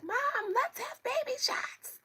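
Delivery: put-on very high, loud child voice; excited little kid. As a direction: beg Mom in a drawn-out squeal. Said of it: "walk up to you ... bottles like".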